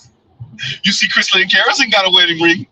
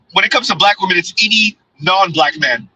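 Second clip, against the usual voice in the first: normal talking voice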